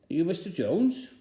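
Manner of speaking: high, loud eager question; loud spy voice. Acted. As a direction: call it out at the open door. Spy knocks, asks at door.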